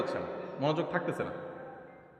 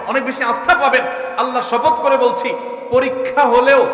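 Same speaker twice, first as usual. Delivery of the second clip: loud, high swearing; fervent preaching; 7 semitones higher, 7 dB louder than usual. Real, not acted. Preacher swearing to the crowd, loud and high. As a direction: build to a fervent oath.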